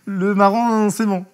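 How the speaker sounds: high and loud, nervously double-checking; anxious doubting. Retold or imitated. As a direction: ask again, unsure and worried. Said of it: "je lui dis".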